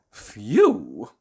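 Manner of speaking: breathy sigh of relief; relieved phew. Acted. Mister Jelly relieved it was a twig. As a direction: let the fear out.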